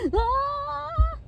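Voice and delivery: high loud "ah" yelp; very high, loud acted scream; acted cry out. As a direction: yelp as if just kicked. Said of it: "and he was like".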